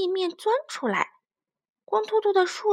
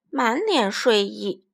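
plain reading voice

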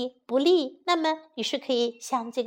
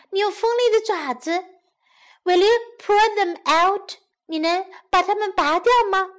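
put-on wary young girl; 7 semitones higher, 3 dB louder than usual. Girl pointing out the lion's claws, high wary girl voice. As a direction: pitch it up as a worried girl.

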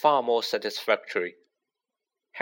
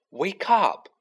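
high-pitched wake-up call; raised, higher voice, moderately loud; 8 semitones higher, 3 dB louder than usual. A narrator's reading. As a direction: call out to rouse a sleeper. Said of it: "she said loudly".

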